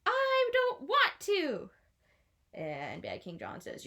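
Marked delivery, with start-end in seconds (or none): 0.0-1.7 high, slow whiny refusal; reluctant Maid Marian. A narrator's reading. Maid Marian refusing the kiss, high slow whine. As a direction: drag it out like a sulky girl. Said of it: "she goes".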